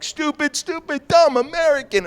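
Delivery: hammed-up self-insult for the cop; selling it. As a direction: grovel hard and sell it. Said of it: "I'm like".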